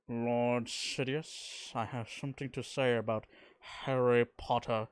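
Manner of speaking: slow, drawling Snape voice; lightly voiced Snape, slowed right down; a hint of a Snape drawl; Snape impression. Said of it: "doing a Snape and Darth Vader voice".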